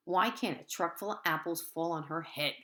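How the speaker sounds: ordinary delivery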